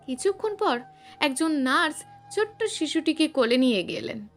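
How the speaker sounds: ordinary delivery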